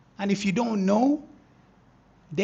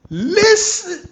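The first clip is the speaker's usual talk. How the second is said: much higher, loud listen; excited; 11 semitones higher, 11 dB louder than usual. Real, not acted. Trader grabbing viewers' attention, excited. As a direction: burst out with it.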